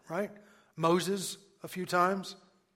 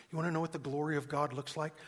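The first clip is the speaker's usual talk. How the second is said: usual speaking voice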